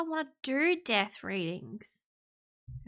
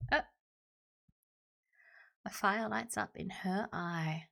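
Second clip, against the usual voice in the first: ordinary talk, nothing marked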